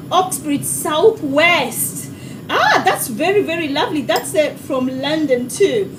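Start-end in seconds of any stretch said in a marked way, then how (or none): none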